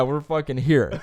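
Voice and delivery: normal talking voice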